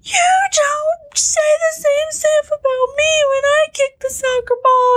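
high whiny complaint; whiny kid voice. Retold or imitated. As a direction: whine it like a jealous child. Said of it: "And Sabrina was like".

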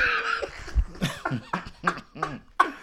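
unvoiced wheezing laugh; breathy silent laugh. Real, not acted. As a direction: laugh with no voice, just air. Reading a funny tweet.

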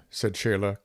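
ordinary delivery, nothing marked

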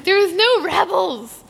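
high-pitched, hammed-up lament; upset outburst. Fan retelling complaint to friend about missing Rebels, dramatic upset outburst. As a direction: protest like it's a tragedy.

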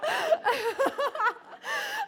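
long high laugh, loud; squealing laughter; high shrieking laugh. Real, not acted. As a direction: laugh out in disbelief.

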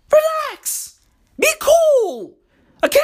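very high-pitched plea; exasperated outburst. Real, not acted. Telling pushy companies to chill, high exasperated cry. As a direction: send it way up high, fed up.

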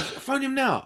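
hammed-up insistence; demanding fan. Fan demands a phone call.

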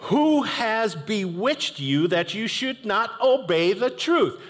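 high, slow, exasperated question; sarcastic, upset. Retold or imitated. Paul chiding the Galatians, high and exasperated. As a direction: ask it like a fed-up apostle. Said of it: "he's kinda sarcastic".

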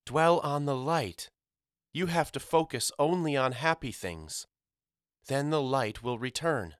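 a hint of a mother coaxing them toward hope; mother's urging voice. Mama urging her sons to think happy thoughts.

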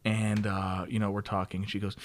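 ordinary talk, nothing marked

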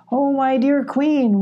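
slow, high, haunting flattery. A narrator's reading. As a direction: draw it out like an enchanter. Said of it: "in a deep, haunting voice".

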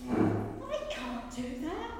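loud, slow, mildly fearful protest; trembling protest. A narrator's reading. Moses refusing God's task, mildly alarmed and loud. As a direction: push back with a touch of alarm. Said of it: "Moses trembled".

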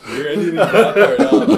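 high and loud, words squeezed out through laughter; laughing hard. Real, not acted. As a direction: plead through the giggles. Laughing, telling friend to cut it.

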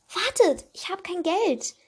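put-on girl realising she has no money, calling out; sudden alarm. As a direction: stop them, you just remembered. Raven suddenly realises, calls out.

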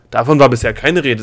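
loud, upset protest; agitated. A narrator's reading. Princess objecting to an unannounced test, agitated. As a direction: call it out in agitation. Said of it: "rief Delia aufgebracht".

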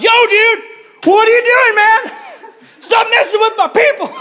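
very high, loud mock yell; shouting God. God yelling at Saul as a dude, high hammed-up shout. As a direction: holler it like an outraged dude. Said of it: "I like to think of it as like".

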